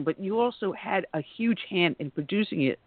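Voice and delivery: ordinary delivery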